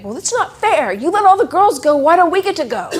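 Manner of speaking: put-on high, loud, indignant kid whine on NOT; a student complaining that it's unfair; whiny back talk. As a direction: sass the teacher like an outraged student. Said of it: "It's the back talk".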